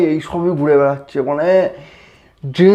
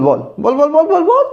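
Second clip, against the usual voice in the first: rising, badgering chant of বল; urging; 10 semitones higher than usual. Pressing his friend to answer, rising badgering chant. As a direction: push him harder with each বল, climbing high.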